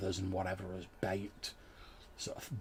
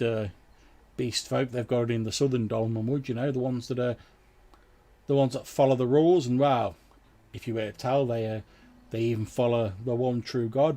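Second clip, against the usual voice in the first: slightly raised, hesitant villager voice; disapproving fisherman; 3 semitones higher, 7 dB louder than usual. Acted. Fisherman telling travellers about foul Crookhorns, mildly indignant. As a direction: complain about a local menace, speaking up.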